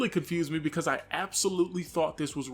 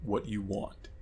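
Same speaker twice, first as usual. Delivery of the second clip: low, quiet, slow and weird demon; eerie demonic child, hushed and low; deep demonic voice; 7 semitones lower, 5 dB quieter, slower than usual. Retold or imitated. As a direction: drop it deep and draw it out softly, like a demon. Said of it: "in a really weird, deep, demonic voice".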